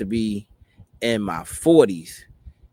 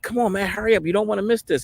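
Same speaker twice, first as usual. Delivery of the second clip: higher-pitched urgent mimicry; hurrying him along; 11 semitones higher, faster than usual. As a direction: act out someone rushing the father-to-be. Man hurrying him up, acted out.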